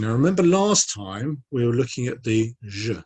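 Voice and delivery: ordinary talk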